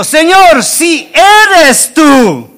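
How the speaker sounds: high, drawn-out call to someone distant. Acted. Peter calls to Jesus on the water, calling out. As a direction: call out slowly over the storm.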